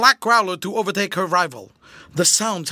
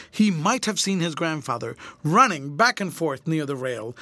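plain reading voice

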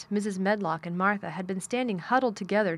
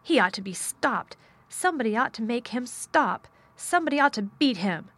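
raised, louder outburst at the end; indignant cry; 5 semitones higher, 4 dB louder than usual. A narrator's reading. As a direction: cry out in mounting outrage. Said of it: "she cried out".

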